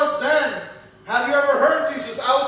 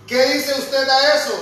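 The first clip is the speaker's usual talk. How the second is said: ordinary talk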